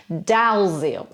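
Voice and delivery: "Dalziel" said DAL-zeel as written; wrong spelling reading. Shown as a demonstration. Teacher shows the detective's name misread. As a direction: read "Dalziel" letter by letter. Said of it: "it's not Dalziel".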